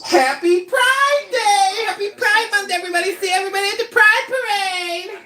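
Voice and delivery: excited. Real, not acted. Cheering on Pride celebration.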